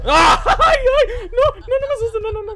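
shrieking half crying in real fright; terrified scream. Real, not acted. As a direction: beg the killer not to scare you, shrieking. Killer attacks in the game.

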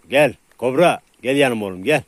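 loud, urging recall; calling a dog. Real, not acted. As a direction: call the dog to your side. Calling a dog to his side. (yüksek sesle yanına çağırma)

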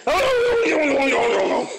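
high laughing no's; laughing protest. Real, not acted. Owner laughing as the dog lunges for the treat.

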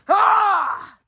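loud, sudden scream of alarm; jump-scare yelp; startled scream. Real, not acted. Gamer frightened in the game, sudden loud scream.

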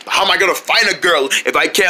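usual speaking voice

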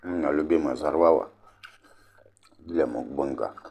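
words muffled by food; chewing between words; talking while chewing. Real, not acted. Seen 1: Cheeks bulge and jaw works throughout; radish not itself visible.

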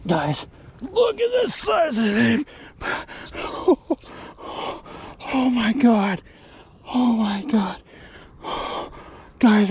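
thrilled, high and drawn out; gasping in disbelief at a huge catch; excited. Real, not acted. Reacting to a huge fish.

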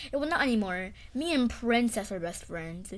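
ordinary talk

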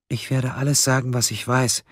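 high, small, careful voice; fragile suspect. A narrator's reading. Fragile suspect repeats her offer. (zerbrechlich, mit hoher Stimme)

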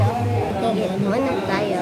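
usual speaking voice

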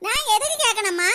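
usual speaking voice

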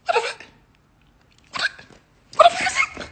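rising shocked squeal. Retold or imitated. Shocked at broken glasses.

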